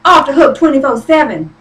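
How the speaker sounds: usual speaking voice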